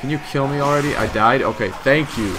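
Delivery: loud, fed-up complaint; irritated, loud gamer griping; exasperated shouting. Real, not acted. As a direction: raise the voice in impatient irritation. Impatient for death, then sarcastic thanks.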